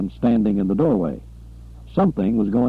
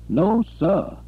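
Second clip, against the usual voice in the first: a higher, emphatic no sir; pitched-up emphatic denial; 9 semitones higher than usual. A narrator's reading. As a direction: insist it firmly. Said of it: "Buford asserted emphatically".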